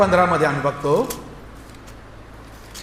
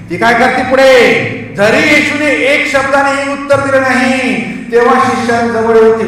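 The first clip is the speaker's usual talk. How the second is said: high, loud preacher's voice; rousing, raised sermon delivery; full-force preaching; 6 semitones higher, 5 dB louder than usual. Real, not acted. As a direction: proclaim it forcefully.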